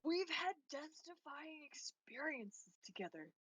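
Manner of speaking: slow, mock-earnest plea; milk the sincerity. Thief pleads bond, slowly.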